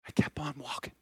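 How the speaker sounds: hushed whisper, almost breath. Acted. Whispering it back to the church. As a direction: let it fall to a whisper for the congregation. Pastor repeats the line for effect.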